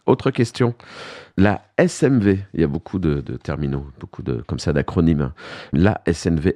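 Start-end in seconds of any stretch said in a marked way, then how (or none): none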